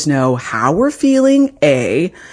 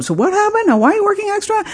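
high mock husband voice; squeaky parody of her husband asking; imitating husband; faster than usual. Wife mimicking husband asking why she works extra.

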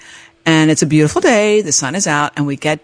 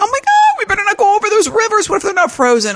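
mock panic. Panicking about frozen rivers.

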